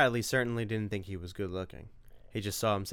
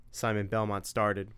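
ordinary delivery

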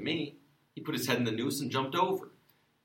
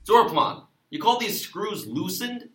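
yelling; 8 semitones higher, 5 dB louder, slower than usual. Acted. Sheriff yelling for deputy.

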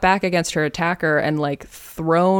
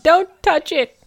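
high, louder panicky squeal; hammed-up plea to protect a sore wrist; pained protest; 12 semitones higher, 5 dB louder than usual. Suspect to woman examining his wrist, mock panicked protest. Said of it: "and he's like".

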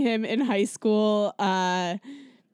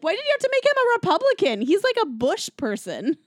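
indignant; 9 semitones higher, 4 dB louder than usual. Real, not acted. Indignant rant about movies.

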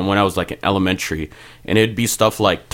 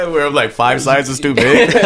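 laughing; 6 semitones higher, 4 dB louder than usual. Real, not acted. Laughing over oversized shirts.

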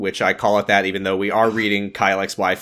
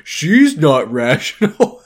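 mock whiny voice; 4 semitones higher, 6 dB louder, slower than usual. Reading a character's sexist fear.

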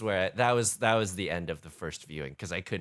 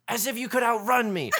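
loud mock yell, high and hammy; mock scream; 13 semitones higher, 6 dB louder than usual. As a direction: yell it like a melodramatic vampire. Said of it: "he just screams".

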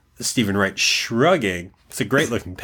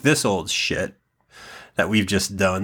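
ordinary delivery

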